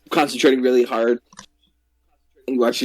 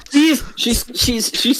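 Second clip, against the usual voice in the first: excited; 9 semitones higher, slower than usual. Acted. GM voicing excited child.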